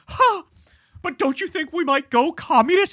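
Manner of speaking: high, dopey mock reporter voice. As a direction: play a clueless reporter asking in earnest. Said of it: "reporters, in all seriousness, going".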